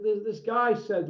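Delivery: ordinary talk, nothing marked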